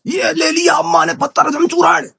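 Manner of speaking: high-pitched hype shout; high, showy announcement; playful announcing shout. Pretend. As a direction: proclaim it like a big event. Hyped announcement of taking the powder.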